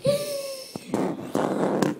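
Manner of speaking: big high gasp; mock shocked gasp. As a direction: gasp in horror at the video. Reacting to own video.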